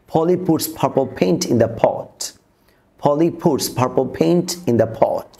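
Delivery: slow tongue twister, puff of air on each p in Polly, puts, purple, paint, pot; measured delivery, aspirated p in Polly puts purple paint; aspirated p drill. Shown as a demonstration. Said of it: "তিনটা সাউন্ডসকে আমরা এভাবে".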